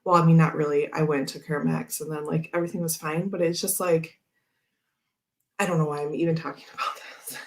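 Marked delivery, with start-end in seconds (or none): 5.6-7.4 breathy aside with a little laugh; embarrassed whisper. Real, not acted. Vlogger whispering to herself about rambling, embarrassed whisper. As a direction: whisper it to yourself, sheepish.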